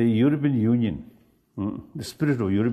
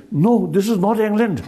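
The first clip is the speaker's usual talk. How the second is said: loud, high indignant retort in a put-on Scottish accent; mock Scottish accent; 10 semitones higher, 6 dB louder than usual. Friend immediately corrects him about Scotland, loud mock Scottish accent. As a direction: imitate an offended Scot correcting someone, loudly. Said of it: "nice English Scottish accent".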